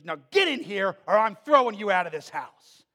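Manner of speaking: loud threatening yell; angry mother. Retold or imitated. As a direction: threaten him like a furious mom. Mother threatens son, yelling.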